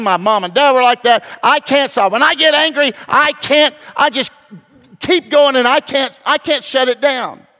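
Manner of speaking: building from pleading to a loud, high rant; imitating a hot-tempered listener who insists he can't stop; angry excuse. Listener pleading with the pastor, growing into a loud angry rant. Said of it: "And I know what some people say".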